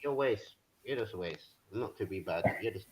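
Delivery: low, soft insult, away from the mic; barely audible roast. Real, not acted. Panelist roasting the host off-mic, barely audible. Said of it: "I can't even hear how you're roasting me".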